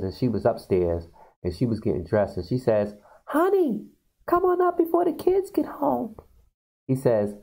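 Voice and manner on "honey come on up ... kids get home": high falsetto sweet housewife call; mock sweet housewife calling her husband; falsetto wife. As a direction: coo upstairs in a high sugary falsetto. Wife calls husband upstairs.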